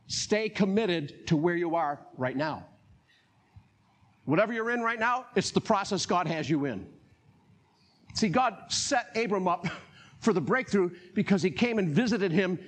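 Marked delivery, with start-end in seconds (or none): none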